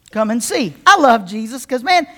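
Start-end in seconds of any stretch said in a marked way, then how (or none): 0.1-0.7 lower, softer, calm invitation; gentle invitation. Retold or imitated. Jesus gently inviting the two disciples, softer. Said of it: "he goes".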